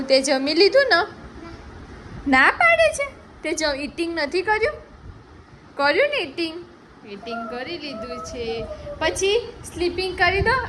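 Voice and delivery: very high, loud cooing. Real, not acted. Playful talk to the baby.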